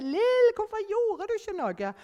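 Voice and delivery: put-on high, loud child's shriek; children yelling. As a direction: shriek it like the kids behind her. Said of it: "springende og hylte". (høyt, skingrende barnerop)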